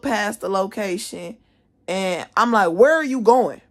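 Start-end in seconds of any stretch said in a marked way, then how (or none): 2.7-3.6 raised, high indignant demand; retold yell. Student yelling after staff member walking past the office, retold yell. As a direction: call it out loud and high, indignant. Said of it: "Now I'm yelling at him".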